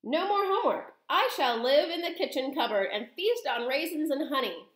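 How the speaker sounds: high, happy boast; gleeful boy. A narrator's reading. Boy rejoices at being a mouse.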